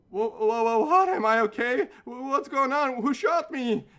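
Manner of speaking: high, loud panicked stammer, imitated; mock a man in sudden panic; hammed-up frantic questions, tripping over the first word; freaking out. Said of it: "Mike just freaks out".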